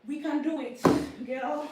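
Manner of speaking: loud, pumped-up cheer to herself; self pep talk. Real, not acted. Psyching herself up mid-workout, loud cheering. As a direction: fire yourself up, loud. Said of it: "I'm giving myself motivation".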